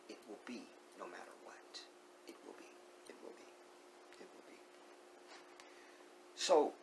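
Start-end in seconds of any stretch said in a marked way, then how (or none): 0.1-4.6 repeated words sinking to a whisper; hushed, insistent fade-out; fading whisper. Real, not acted. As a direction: let each repeat fade softer.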